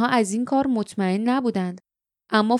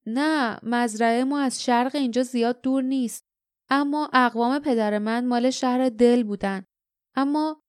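ordinary delivery, nothing marked

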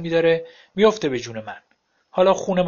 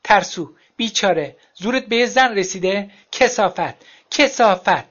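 building to a shouted curse; furious insults; 5 semitones higher, 5 dB louder than usual. A narrator's reading. Ex-wife cursing him after the fight, building angry shout. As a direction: spit the insults, louder each time.

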